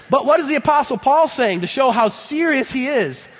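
ordinary delivery

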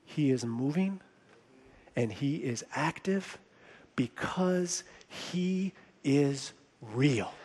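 low, soft, deliberate close; hushed conviction. Real, not acted. Preacher closing the sermon before prayer, hushed conviction. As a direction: drop right down and let each phrase land.

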